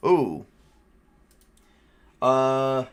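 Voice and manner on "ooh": normal talking voice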